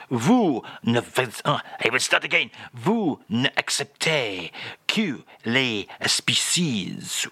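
[name]. Acted. French guest repeats phrase on request, mock [name]. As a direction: labour grandly through the French phrase. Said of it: "And again, please, Charles".

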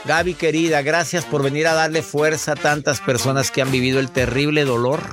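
ordinary talk

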